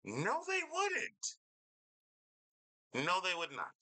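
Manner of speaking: mocking. Mocking the streamer's claim about chat.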